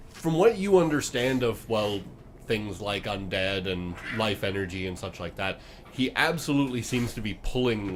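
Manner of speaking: ordinary talk, nothing marked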